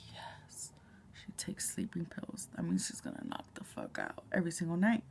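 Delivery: hushed, unvoiced relief; relieved whisper. Retold inner relief.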